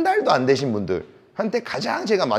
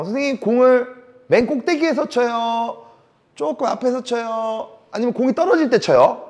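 imitating beginner students; 8 semitones higher than usual. Beginners always asking the coach when to hit, imitated higher-pitched questioning. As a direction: mimic puzzled learners, pitched up. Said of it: "항상 저한테 많이 물어봐요".

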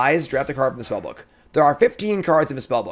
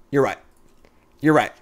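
ordinary talk, nothing marked

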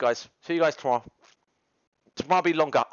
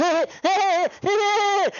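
manic laugh; 11 semitones higher than usual. Retold or imitated. Acting like an idiot.

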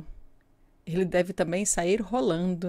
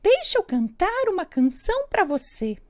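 high loud bun voice; little bun voice; 10 semitones higher, 4 dB louder than usual. Acted. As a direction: chirp it like a small bun. Bolinho fala. (voz aguda de bolinho)

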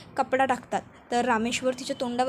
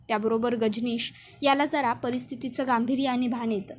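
usual speaking voice

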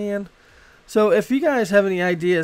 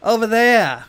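loud, drawn-out Boston accent with broad "ah" vowels; 5 semitones higher, 7 dB louder, slower than usual. Shown as a demonstration. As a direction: demo Boston speech, dropping the R's into long "ah". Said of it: "Boston accent is any time you say any vowels".

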